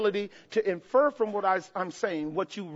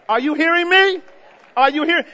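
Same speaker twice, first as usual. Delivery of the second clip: loud, higher call to the crowd; 5 semitones higher, 6 dB louder than usual. Real, not acted. Preacher calling to the congregation, loud and high. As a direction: demand an answer from the pews.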